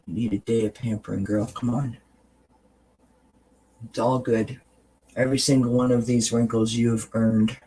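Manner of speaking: tender reassurance to a tired friend in the mirror; kind mirror talk. Shown as a demonstration. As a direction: talk to yourself like your best friend. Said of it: "And you say this".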